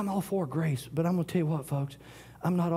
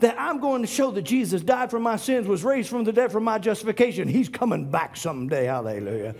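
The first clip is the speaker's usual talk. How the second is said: loud, high preaching call; fiery preaching; 6 semitones higher, 6 dB louder than usual. Acted. Preacher rousing the congregation, loud and high. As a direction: preach it out to the church.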